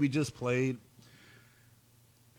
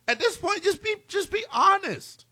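very high, incredulous appeal; exasperated plea. Acted. Host begs the absent coach for honesty, exasperated. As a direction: beg him, pitch shooting way up, dragging the last words.

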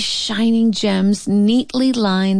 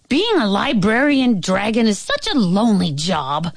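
ordinary delivery, nothing marked

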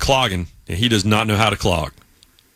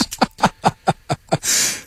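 breathy laugh; 6 semitones higher than usual. Real, not acted. Laughs at own retold outburst.

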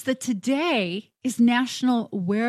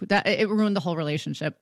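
normal talking voice

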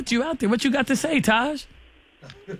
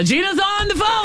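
high, hyped radio announcement; big reveal shout; 10 semitones higher than usual. Real, not acted. Host revealing the hidden date on the line, shouted with excitement. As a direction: call it out like a show reveal.

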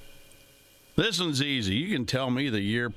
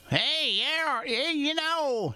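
high, drawn-out character impression; hammed-up high cowboy sidekick voice, stretching the last word; Festus; much slower than usual. Radio host mimics a TV western character, high exaggerated drawl. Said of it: "he doesn't even talk like that".

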